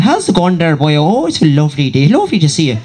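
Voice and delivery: lilting Cork-to-Wexford Irish, swooping up and down; sing-song southern Irish accent. Shown as a demonstration. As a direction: put on a musical Cork accent. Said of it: "the sort of sing-song".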